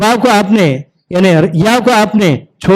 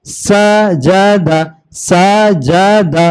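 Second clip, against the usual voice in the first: slow drawn-out demo; much slower than usual. Shown as a demonstration. Teacher drawing out the word slowly for a child learning to read. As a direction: stretch each syllable slowly for the pupil. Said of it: "ऐसे ही चलेंगे आप".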